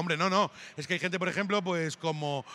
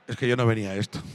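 mock reluctant guy; 8 semitones lower than usual. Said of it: "está Óscar así en plan".

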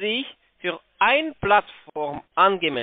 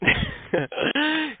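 laugh; 11 semitones higher than usual. Real, not acted. Scammer laughs off accusation.

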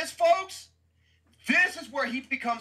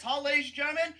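usual speaking voice

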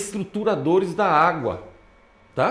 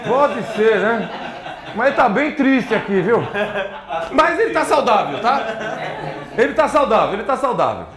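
laughing; 4 semitones higher than usual. Real, not acted. Laughing while showing baby photos.

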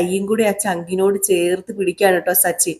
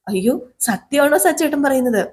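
surprised Revathi; 7 semitones higher than usual. Retold or imitated. Revathi surprised at Sachi's words.

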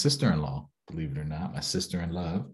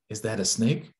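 plain reading voice

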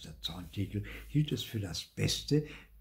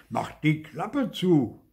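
louder, higher, slow indignant command; called out; 5 semitones higher, 5 dB louder, slower than usual. A narrator's reading. Zorntigel silencing the laughing mouth, calling out. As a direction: snap the order out loudly. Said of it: "rief Zorntigel".

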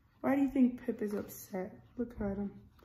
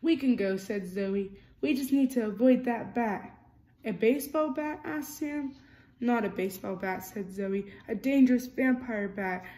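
lively storybook reading, character lines a bit louder; reading to children; 2 semitones higher, 6 dB louder than usual. A narrator's reading. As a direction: read it like a bedtime picture book. Reading picture book aloud.

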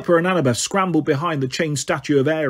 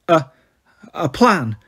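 stammering; slower than usual. Acted. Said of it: "she stammered".

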